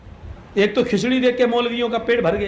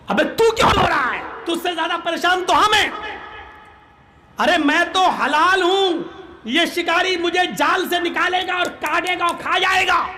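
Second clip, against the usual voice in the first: higher, indignant yelling; exasperated pigeon; 6 semitones higher than usual. Acted. Pigeon scolding the crow for crying, higher-pitched indignant yell. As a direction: snap back at him, fed up and aggrieved.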